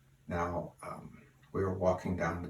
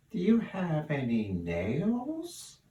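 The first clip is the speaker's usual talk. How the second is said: high, slow duck voice, drawn out innocently; 9 semitones higher, 4 dB louder, much slower than usual. Acted. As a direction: play the duck slow and sly. Punchline: duck asks for nails.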